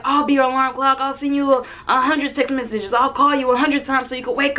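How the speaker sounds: high, keen voice imitating the guy; lightly mocking impression of a date trying too hard; eager date. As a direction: play the overeager suitor promising the world. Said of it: "And he was like".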